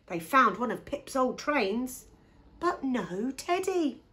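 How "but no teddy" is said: ordinary delivery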